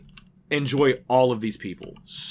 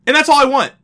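higher and louder, fed up; exasperated outburst; 12 semitones higher, 7 dB louder than usual. Real, not acted. Wrestler ranting to cohost about AEW, loud exasperated outburst. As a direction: slam the point home in frustration.

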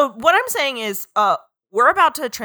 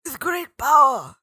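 superhero impression; 3 semitones higher, slower than usual. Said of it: "here's my superhero impression".

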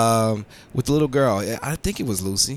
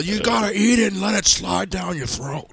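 high, put-on villain voice into a laugh; hammed-up villain voice breaking into laughter; Star Trek villain quote; 9 semitones higher than usual. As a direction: ham up the villain. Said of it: "that is what he says in Star Trek".

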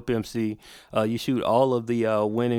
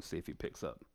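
whispered; 5 semitones lower, 17 dB quieter than usual. Real, not acted. Muttering while dialing.

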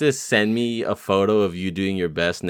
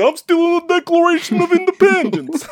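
much higher, louder cartoon voice; Bucky Buchanan voice; 5 dB louder than usual. Host joins in the Bucky voice, high and loud. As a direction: squeak it out like a silly cartoon character. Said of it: "the Bucky Buchanan voice".

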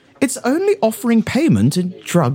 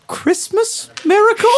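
hammed-up exclamation; 13 semitones higher than usual. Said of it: "You might say".

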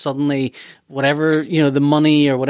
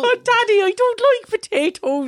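put-on high, slow whining Irish boy; whiny child voice. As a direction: whine like a little kid.

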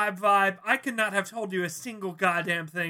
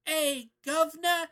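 high, slow, hammed-up British accent; over-the-top posh British insult; mock British accent; 10 semitones higher, slower than usual. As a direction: parody a Victorian gent cursing at a centaur, British accent. Mocking the show's British dialogue.

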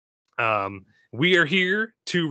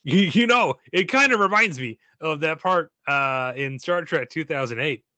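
impression of Scott; 2 semitones higher than usual. Host doing his absent cohost Scott, a raised put-on voice. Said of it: "Let me do Scott".

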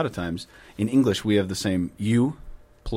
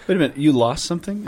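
mock surprise; 7 semitones higher, 5 dB louder than usual. Teasing friend who always loses things.

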